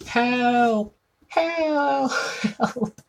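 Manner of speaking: very high, strained voice calling for help; mock cries for help. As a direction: cry out for help, a hammed-up old lady.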